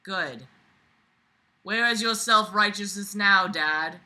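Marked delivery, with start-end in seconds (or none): none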